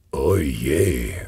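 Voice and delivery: mild dismay, narrated; a touch of alarm on remembering; understated startled "oh dear". A narrator's reading. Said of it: "ruft Kugelblitz erschrocken".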